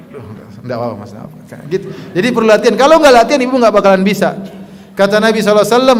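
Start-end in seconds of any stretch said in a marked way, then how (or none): 0.1-1.7 soft, hushed reply, swallowing the anger; calm, held back. Shown as a demonstration. Wife calmly answers her husband, soft and restrained. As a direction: keep it down, say it's nothing. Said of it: "Jangan angkat suara, tenang, sabar".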